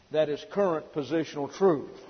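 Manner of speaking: ordinary talk, nothing marked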